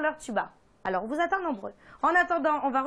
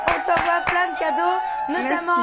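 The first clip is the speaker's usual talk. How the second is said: excited announcing; 3 semitones higher, 7 dB louder than usual. Real, not acted. Announcing prizes to caller.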